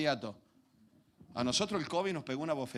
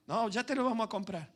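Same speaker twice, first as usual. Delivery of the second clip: a hint of a put-off parent voice; stalling parent; 8 semitones higher than usual. Preacher voices his parents delaying the toy. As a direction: stall the kid lightly.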